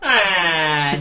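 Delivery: long, loud mock wail; imitated yell. Imitating the comedian's sketch ending for friends, mock yell. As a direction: let out a drawn-out cartoon scream. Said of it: "would just be him going".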